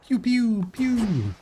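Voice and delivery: high playful laser noises; pew pew sound effect. Retold or imitated. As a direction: mouth blaster sounds, high pitched. Imitating a spell firing.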